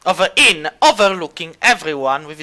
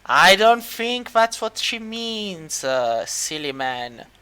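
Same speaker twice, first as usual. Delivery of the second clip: unhurried Sphinx, trailing down softly; 5 dB quieter, slower than usual. Acted. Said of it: "looks back at him and goes".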